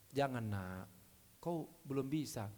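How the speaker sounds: a hint of a quiet old father; gentle father. Father in the parable refusing his son, low and soft.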